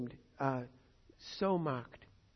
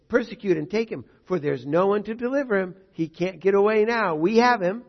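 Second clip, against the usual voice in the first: raised, louder voice, building to the end; enemies' triumphant cry; 5 semitones higher, 9 dB louder than usual. Acted. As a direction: call it out higher and louder, as pursuers closing in. Voicing enemies closing in on David.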